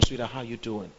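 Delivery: soft, gentle coaxing; husband coaxing his wife about moving to Ghana; sweet-talking husband. Shown as a demonstration. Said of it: "you're gonna come".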